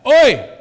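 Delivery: "Oi!" shouted high and loud like a toast; booming Oi. Shown as a demonstration. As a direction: bark "Oi!" like raising a beer stein. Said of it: "sounds like a German lifting a stein".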